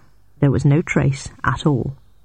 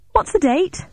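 high-pitched called question; calling out; 13 semitones higher than usual. A narrator's reading. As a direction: call the question over to a stranger. Calling to man in car.

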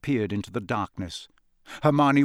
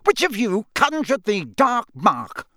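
high, slow, enraged interrogation; snapping accusation; 8 semitones higher, 4 dB louder than usual. A narrator's reading. Crouch glaring between the suspects, angry accusation. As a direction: demand a confession word by word. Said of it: "he snapped".

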